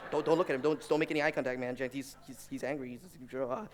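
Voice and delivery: ordinary talk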